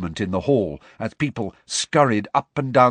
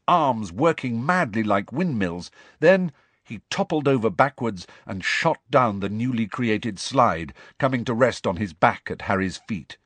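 plain reading voice

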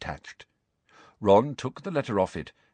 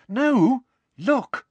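excited; 13 semitones higher, 4 dB louder than usual. A narrator's reading. Hermione points excitedly.

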